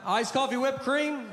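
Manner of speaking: high mock call-out of an order; imitated yelling out. Barista announces a drink to the waiting line, mock yell. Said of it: "She just yells out".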